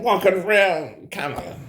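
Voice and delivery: normal talking voice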